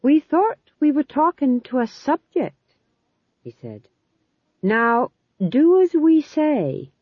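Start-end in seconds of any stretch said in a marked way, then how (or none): 0.0-2.8 high, proud, arrogant king; haughty monarch pulling rank; royal hauteur. A narrator's reading. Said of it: "blast of royal hauteur, pride and arrogance". 4.6-6.9 high, slow, louder regal command; king who expects instant obedience; royal hauteur. A narrator's reading. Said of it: "blast of royal hauteur, pride and arrogance".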